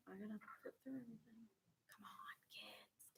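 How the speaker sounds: hushed unvoiced aside; whispering. Real, not acted. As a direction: breathe it under your breath. Teacher muttering while grading.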